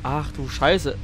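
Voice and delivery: ordinary talk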